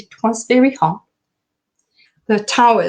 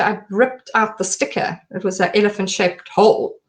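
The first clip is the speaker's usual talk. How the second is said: ordinary talk, nothing marked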